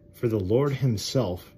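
loud, slow, solemn Bible reading; preacher reading scripture out loud. Acted. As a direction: proclaim the verse slowly to the congregation. Reads scripture aloud.

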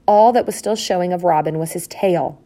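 ordinary delivery, nothing marked

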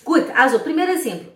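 ordinary talk

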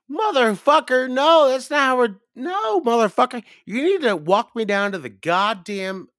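high, loud, cursing protest; exasperated yelling. Retold or imitated. Cabin guest to Pap over vague instructions, exasperated yell. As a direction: cut him off with a high-pitched rant.

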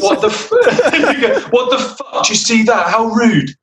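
high, indignant, half laughing; a stranger ignores the greeting, mock outrage through laughter. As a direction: play the offended hiker through giggles. Retelling a rude passerby.

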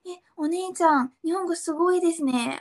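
imitating an impressed mum; impressed praise. Acting out the mother's admiring praise.

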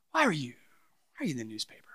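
retold question sinking almost to a whisper; hushed question. Pastor asking a departing staff member in the exit interview, hushed.

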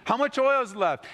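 high, bubbly, amazed chatter; giddy widow. Pretend. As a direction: play the widow thrilled at the endless flour. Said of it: "They're probably talking about it".

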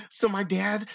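put-on Californian kid voice; California voice. Reader telling the kid's story about dad, California voice. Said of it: "do this in a California voice".

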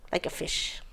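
hushed, whispered gangster menace; mobbish threat. Acted. Woman threatening man in store line, whispered mobbish menace. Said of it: "I'm very mobbish right now".

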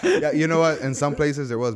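laughing while conceding. Real, not acted. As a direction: chuckle through the words. Laughing at the horses joke.

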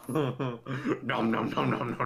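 Cookie Monster voice. Pretend. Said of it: "weird Cookie Monster impression".

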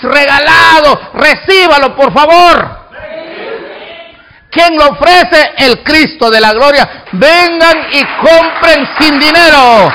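preaching at full force, shouting the free offer; fiery preaching. Acted. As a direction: call the crowd in like a street preacher.

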